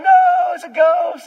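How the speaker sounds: loud, high, panicked yell; hammed-up terrified disciple; mock shout. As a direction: yell over the storm in fear.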